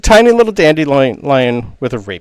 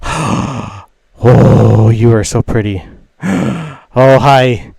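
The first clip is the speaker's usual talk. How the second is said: smitten gushing; 5 dB louder, slower than usual. Acted. Dandelion is smitten with a traveler.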